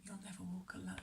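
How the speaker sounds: hushed breathy whisper of the motto; whispered slogan. Acted. Reading the envelope slogan to viewers, whispered.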